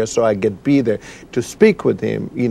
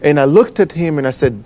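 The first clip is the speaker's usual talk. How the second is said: ordinary talk, nothing marked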